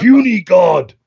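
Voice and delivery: lightly voiced comic-book brute; mock brute impression; the Hulk. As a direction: do a monster superhero catchphrase. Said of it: "wasn't that the Hulk?".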